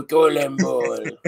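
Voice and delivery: Colon bowl, slow and drawn out in a French accent. Shown as a demonstration. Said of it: "That's awful".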